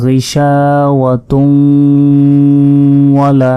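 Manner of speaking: "গিশাওয়াতুন" ending in a long nasal hum into "ওয়ালা"; joined with ghunnah. Shown as a demonstration. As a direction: slowly merge "গিশাওয়াতুন" into "ওয়ালা" through the nose. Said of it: "গুন্না সহ". ("গিশাওয়াতুন ওয়ালা" গুন্না সহ মিলিয়ে)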